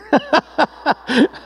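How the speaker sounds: high rapid chuckle; high, rippling laugh; delighted laugh. Real, not acted. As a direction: laugh in a quick high string. Laughing at song being hers.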